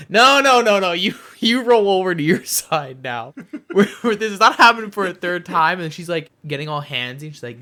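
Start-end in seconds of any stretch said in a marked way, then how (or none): 0.1-5.8 loud, laughing protest; laughing refusal. Retold or imitated. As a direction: push her off through laughter.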